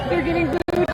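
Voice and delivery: thrilled the crowd is booing; delighted exclaim. Real, not acted. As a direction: say it again with glee. Repeats it, delighted, over clip.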